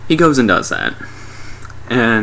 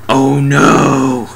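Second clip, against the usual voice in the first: drawn-out, louder comic dismay; mock horror; 4 semitones higher, 5 dB louder than usual. Curtain is down, mock horror. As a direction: overact the horror, higher.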